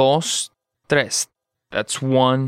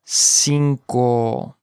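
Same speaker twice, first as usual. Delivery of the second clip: "Cinco" in two long syllables; slow model. Shown as a demonstration. Teacher modelling vocabulary for learners, slow "Cinco".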